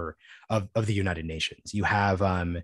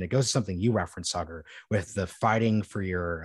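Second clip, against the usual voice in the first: ordinary delivery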